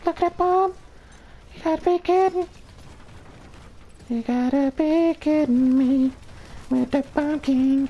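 high exasperated squeal of disbelief. Real, not acted. Gamer to his teammates, high squealing disbelief. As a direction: shriek it like you can't believe the kill. Gamer outraged at enemy Bomb King.

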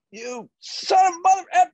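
high, strained yell down the phone; full-voiced furious outburst, acted; boss losing his mind. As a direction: imitate a raging boss exploding. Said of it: "And he lost his mind".

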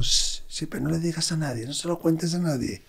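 soft, hushed and hurried; secretive whisper. Retold or imitated. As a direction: keep it secret from everyone. Secret told to schoolmate. (susurro confidencial)

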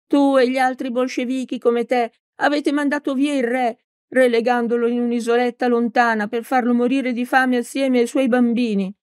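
a lightly voiced old woman accusing; stern old teacher. A narrator's reading. Old teacher accusing Peppone, mildly stern.

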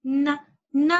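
"ن" as a short "na", twice; nun with fatha. Shown as a demonstration. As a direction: drill "ن" as two separate "na" syllables. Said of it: "حرف النون مع الفتحة كيف ننطقه؟". ("ن" مفتوحة، مرتين)

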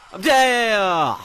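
high, loud, drawn-out cry of defeat; loud dismayed gamer yell; exasperated yell. Real, not acted. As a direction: wail it out on a falling pitch. In-game death, repeated louder.

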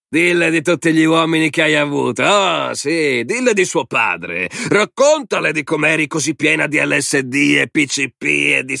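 high, jeering tirade; angry goading. A narrator's reading. Man goads woman before her daughter, jeering. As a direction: jeer at her, pitch pushed up throughout.

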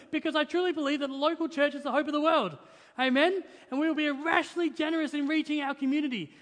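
rally the congregation, voice lifted and pushing; preaching at full force. Acted. Pastor urging the church to give, preaching at full force. Pastor builds to passionate climax.

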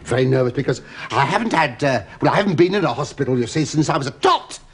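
ordinary talk

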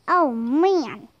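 ordinary delivery, nothing marked

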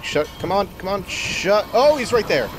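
high frantic pleading; desperate to get the door shut; scared horror game streamer. Real, not acted.

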